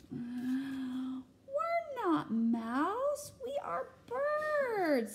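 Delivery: slow, rising high chirpy birds; birds' voice. Acted. Birds answering Max, high and chirpy. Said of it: "say the birds".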